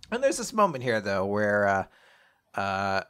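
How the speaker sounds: usual speaking voice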